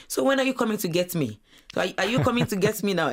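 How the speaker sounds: lightly imitating an impatient mom; mom asking eagerly. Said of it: "she's been calling me now and asking me".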